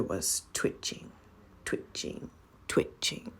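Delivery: fading whisper. A narrator's reading. Narrator fades on repeated word.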